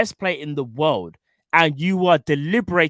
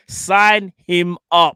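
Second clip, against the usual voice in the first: drawn out, every word stressed; slow emphatic demand; 5 dB louder, much slower than usual. Acted. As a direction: spell it out slowly.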